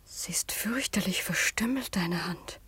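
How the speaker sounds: whispered, fading to almost nothing; horrified whisper. Acted. Daughter sees her fiancé's maimed hand, a horrified whisper. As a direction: breathe it out in horror. Seeing his mutilated hand.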